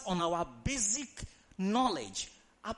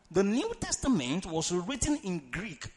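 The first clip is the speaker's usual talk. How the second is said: normal talking voice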